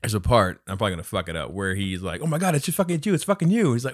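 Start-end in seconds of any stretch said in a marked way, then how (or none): 2.2-3.7 high-pitched mock amazement; retold starstruck outburst; imitating excited fan. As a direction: gush in recognition, lightly acted. Retelling excited fan recognizing her.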